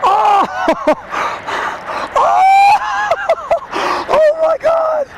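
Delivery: high breathless cold-shock cries; gasping in icy wind. Real, not acted. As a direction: gasp high from the freezing water. Freezing in deep water.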